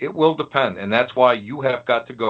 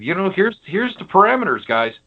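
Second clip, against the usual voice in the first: stern scolding; 2 semitones higher than usual. Retold or imitated. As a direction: scold it like a strict teacher setting rules. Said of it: "And I said".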